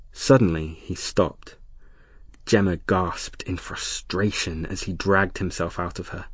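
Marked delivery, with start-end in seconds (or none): none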